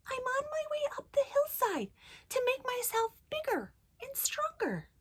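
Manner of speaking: tiny, high little-goat voice; very small voice. A narrator's reading. Littlest goat explaining himself to the troll, small high voice. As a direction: keep it high, small and innocent. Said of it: "in his very small voice".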